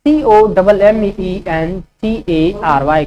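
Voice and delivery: slow letter-by-letter dictation; slow spelling dictation; spelling out slowly. Shown as a demonstration. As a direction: spell each letter clearly for students. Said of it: "commentary का spelling होगा".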